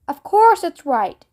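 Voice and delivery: ordinary delivery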